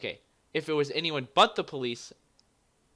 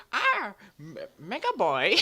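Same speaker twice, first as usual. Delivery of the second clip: cartoon villain voice; 8 semitones higher, 6 dB louder than usual. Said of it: "She's like".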